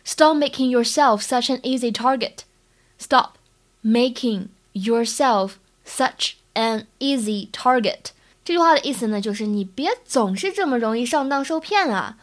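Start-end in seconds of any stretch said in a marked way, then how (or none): 3.0-8.1 slow, word by word with pauses; careful slow dictation pace; slow model reading. Shown as a demonstration. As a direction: break it into slow, separate chunks for learners. Teacher repeats it slowly in chunks.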